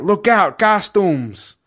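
high, loud cartoon henchman alarm; mock mobster shout. Mobster spotting superheroes, high hammed-up warning shout. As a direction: yell it like a hammy game mobster. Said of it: "they have typical voice acting".

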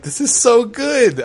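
giddy high delight; gleeful. Retold or imitated. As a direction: savour it like a delighted villain. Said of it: "But I was like".

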